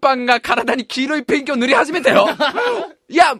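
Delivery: mock play-by-play.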